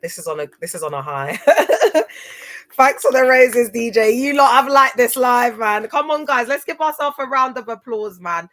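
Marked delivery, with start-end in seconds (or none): 1.4-2.7 loud, high burst of laughter. Real, not acted. Cracks up on stream. 2.7-8.2 loud, excited hype to the chat; hyped thanks. Real, not acted. Hyping live audience.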